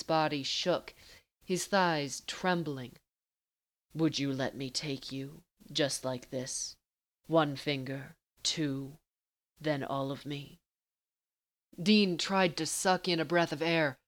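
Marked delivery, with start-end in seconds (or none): 3.9-10.6 low and unhurried, taunting a lover; teasing Castiel. Acted.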